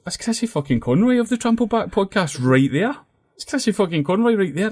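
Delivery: high, loud insisting it's Chrissy fucking Conroy; mock insistence, pitched high; retold insistent shout. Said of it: "Fenton goes".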